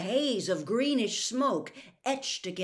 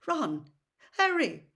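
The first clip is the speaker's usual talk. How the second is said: anxious calling, rising high and loud; shaky voice; 7 semitones higher, 5 dB louder, slower than usual. A narrator's reading. Terrified father striding toward the children, shaky voice. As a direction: call out names in shaken alarm. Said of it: "His voice sounded shaky".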